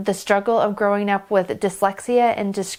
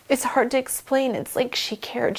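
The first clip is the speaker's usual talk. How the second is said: ordinary delivery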